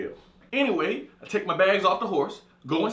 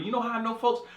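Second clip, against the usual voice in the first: normal talking voice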